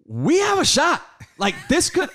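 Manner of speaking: high, loud rallying yell; hyped hope. Retold or imitated. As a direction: burst out like a fan who suddenly believes. Retelling hyped moment of hope.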